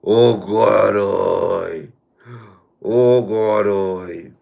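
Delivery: loud wail after laughing hard; drawn-out loud "oh God"; real loud exclamation. Real, not acted. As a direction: cry out, spent from laughter. Recovering from long laughing fit.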